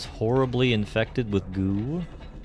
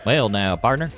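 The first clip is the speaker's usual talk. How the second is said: louder, punchy Texan cowboy twang; Texan accent; 4 semitones higher, 5 dB louder than usual. Shown as a demonstration. Contrasting Texan with Georgian for viewers, Texan accent. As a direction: greet them like a Texan. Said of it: "is more like the Texan accent".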